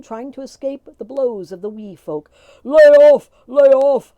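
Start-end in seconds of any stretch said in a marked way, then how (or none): 2.6-4.1 loud, high, desperate pleading yell; begging. A narrator's reading. Magoon begging the tiny men to stop beating him. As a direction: beg for mercy under the blows. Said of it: "begged Magoon".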